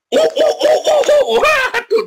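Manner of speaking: high, loud, slow yelping cries, hammed-up; mock panicked yelps. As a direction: yelp like you're being chased off. Mock panic at being beaten.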